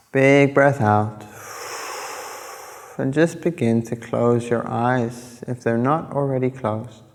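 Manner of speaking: unhurried phrases, leaving space between each instruction; guided meditation. Acted. Yoga teacher guiding listeners through breathing and body awareness, calm meditation voice.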